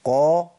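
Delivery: "قا" heavy, with lips pushed forward; pouted qaf. Shown as a demonstration. Teacher shows the lip shape, pouted qaf. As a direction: round the lips for a full, dark "قا". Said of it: "تاخد هيئة تبويزة".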